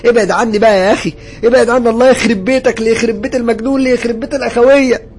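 high, loud hysterical cursing; shouting and screaming. A narrator's reading. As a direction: scream at it to get away. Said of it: "فضلت أزعق وأصرخ وأقول له". (صراخ وزعيق بانهيار)

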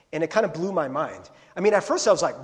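ordinary talk, nothing marked

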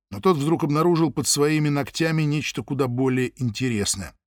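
plain reading voice

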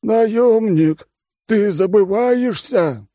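high, loud, slow warning; menacing oligarch. Acted. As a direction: fat tycoon putting him in place. Frowning oligarch threatens.

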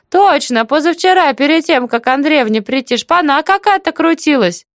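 very high, animated village-aunt voice; chatty deaf auntie, remembering. Acted. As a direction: recall it eagerly, pitched right up. Story figure: aunt recalls.

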